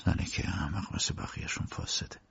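whispered to himself; a hushed private thought, barely voiced; inner thought. K thinking to himself about the woman, whispered. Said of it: "کی با خودش فکر کرد".

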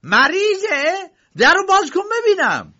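high, loud, outraged yell; angry shout. A narrator's reading. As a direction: shout the demand as the irate uncle. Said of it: "با عصبانیت داد زد". (فریاد عصبانی عمو)

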